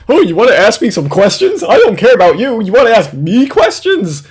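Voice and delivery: loud, high-pitched scornful mimicry; mocking impersonation. Host mimicking Jim about asking questions, scornful mockery. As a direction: throw it out as indignant mockery.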